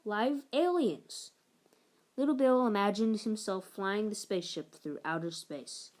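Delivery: plain reading voice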